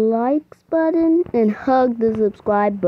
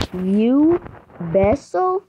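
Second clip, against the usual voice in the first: usual speaking voice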